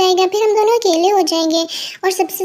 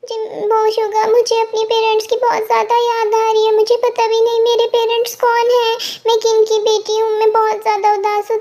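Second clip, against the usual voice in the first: ordinary talk, nothing marked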